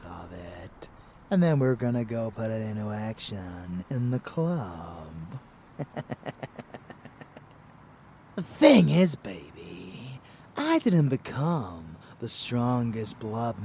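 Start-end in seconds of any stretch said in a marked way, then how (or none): 5.8-7.5 soft, wicked vampire chuckle; sinister chuckle. Acted. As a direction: chuckle darkly to yourself. Vampire anticipates hunt in club.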